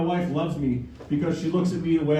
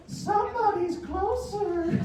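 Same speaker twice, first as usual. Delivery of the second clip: creepy impression; 14 semitones higher, slower than usual. Comic topping his wife, slow high creepy voice. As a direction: draw it out eerily. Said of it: "I was like".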